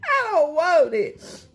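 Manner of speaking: high, laughing while crying; laughing through tears. Real, not acted. Tearing up from onions to her viewers, laughing through tears. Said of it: "I'm literally in tears".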